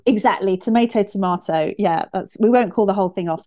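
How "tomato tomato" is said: first tomato as to-MAY-to, second tomato as to-MAH-to; contrasting pronunciations. Shown as a demonstration. Interviewer echoing her guest's joke, tomato as to-MAY-to, then to-MAH-to. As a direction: say tomato with 'ay', then tomato with 'ah'.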